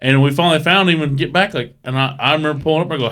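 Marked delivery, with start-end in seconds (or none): none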